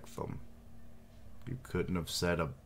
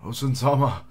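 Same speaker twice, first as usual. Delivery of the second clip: loud, higher cry of the name; alarmed call; 7 semitones higher, 9 dB louder than usual. Acted. Retainer crying out to Osen-sama, loud. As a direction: call out to your lord in alarm. Calling out to the lord.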